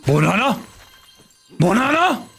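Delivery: mock ecstatic banana shout; building excitement. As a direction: discover the banana, then explode with joy. Finding banana, rising excitement.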